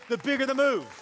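high, loud pulpit punchline; rousing repeat. Shown as a demonstration. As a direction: land it big to excite the crowd. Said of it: "so someone gets excited".

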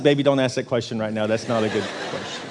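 low, softening, restrained reply; mock weary warning. Exhausted husband answering wife after the hike, mock weary warning. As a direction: play at holding back exasperation, voice sinking.

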